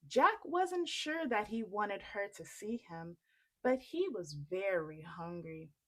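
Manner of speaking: plain reading voice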